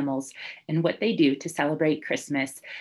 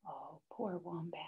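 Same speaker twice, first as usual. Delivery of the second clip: much softer, hushed sympathy; pitying whisper; 19 dB quieter than usual. A narrator's reading. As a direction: sigh it sadly. Narrator pities Wombat.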